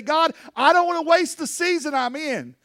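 high, pleading prayer to God; fervent preacher's prayer, pitched high; earnest prayer. Acted. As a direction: pour it out to God, begging to be used. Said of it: "But ask God, say".

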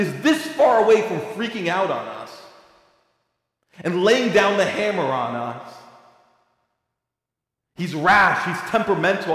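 high, loud, impassioned pulpit cry; preaching at full force. Acted. Preacher urging his congregation, preaching at full force. Describing false view of God.

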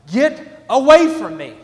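loud, high, slow yell; kid's slow yell. Retold or imitated. Son yelling at dad who grabs his arm. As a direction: drag it out like an angry little boy. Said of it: "and he's like".

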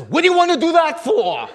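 high, loud mock outburst; indignant café papa. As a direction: challenge him like an affronted café owner. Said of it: "the papa of the outfit says".

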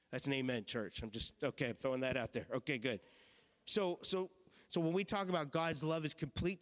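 ordinary talk, nothing marked

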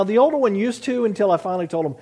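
usual speaking voice

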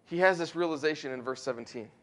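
ordinary talk

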